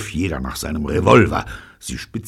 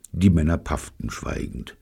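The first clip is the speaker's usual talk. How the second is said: usual speaking voice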